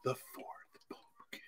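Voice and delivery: slow secretive whisper; hushed, slow and unvoiced. Conspiratorial aside about backup beer.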